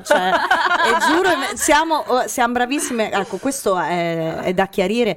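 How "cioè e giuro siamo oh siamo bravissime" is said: laughing. Real, not acted. Joking about being a crowd.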